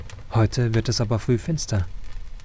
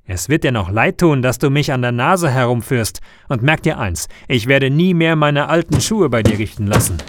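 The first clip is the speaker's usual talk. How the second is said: louder, furious mayor threat; angry; 5 dB louder than usual. Acted. Mayor storming at the shoemaker, angry and loud. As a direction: threaten him in a rising temper. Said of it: "sagt er wütend".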